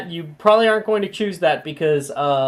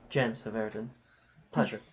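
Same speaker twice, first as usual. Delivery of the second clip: bored eye-roll; 5 semitones lower, 9 dB quieter than usual. Acted. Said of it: "Janice will roll her eyes".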